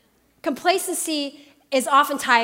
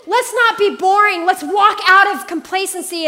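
preaching exhortation; 6 semitones higher, 6 dB louder than usual. Acted. Rising exhortation.

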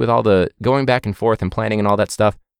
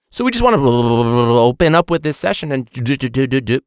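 glitchy connection voice; 4 semitones higher than usual. Retold or imitated. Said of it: "So it'll be like".

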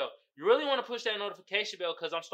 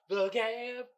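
lifted, stretched title call; changed-up show name; 3 semitones higher, 3 dB quieter than usual. Shown as a demonstration. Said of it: "let me change it up for y'all".

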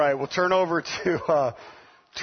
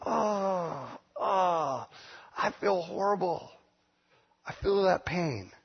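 soft, hammed-up pained moan; mock sympathy; 6 dB quieter, slower than usual.

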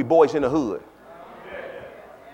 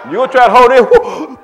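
laughing, loud mock strain; mock holding back tears; 6 semitones higher, 9 dB louder than usual. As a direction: act out fighting the tears, half laughing. Acting someone holding back tears.

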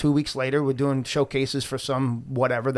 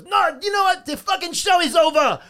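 high, heated mock tirade; worked-up, high-pitched angry imitation; mad club owner; 10 semitones higher than usual. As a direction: act out an irate owner shutting everything down. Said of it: "he's fucking mad about a five dollar coffee".

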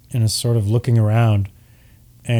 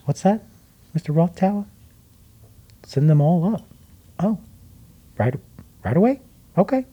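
Myrtle on the pearl; 4 semitones higher than usual. Acted.

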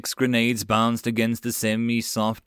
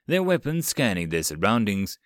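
ordinary delivery, nothing marked

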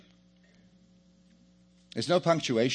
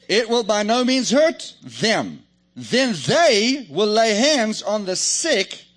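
loud, slow, high preaching; emphatic declaration; 7 semitones higher, 7 dB louder, slower than usual. Real, not acted. Preacher declaring scripture to believers, emphatic. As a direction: proclaim it slowly at full force.

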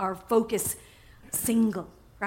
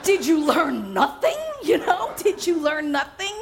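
exasperated Jesus; 5 semitones higher, slower than usual. Pretend. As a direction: play a fed-up teacher, laughing. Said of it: "Could you imagine Jesus standing there going".

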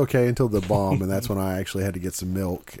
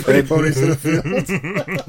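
laughing; 5 semitones higher, 5 dB louder, slower than usual. Real, not acted. Mocking friend's phrase, laughing.

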